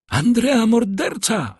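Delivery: high, slow cry of alarm; a woman's high-pitched outcry; exclaimed accusation. A narrator's reading. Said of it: "wykrzyknęła jedna z kobiet".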